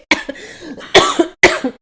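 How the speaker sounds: long choking cough; hacking coughs, tea down the wrong way; choking on a drink. Real, not acted. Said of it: "Je m'étouffe avec mon thé".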